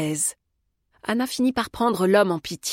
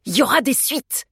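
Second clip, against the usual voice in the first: raised-pitch threat; mildly menacing, higher voice; 6 semitones higher, 4 dB louder than usual. A narrator's reading. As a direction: warn them there will be trouble. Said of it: "déclara l'homme sur un ton menaçant".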